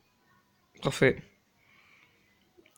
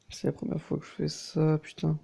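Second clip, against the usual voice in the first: normal talking voice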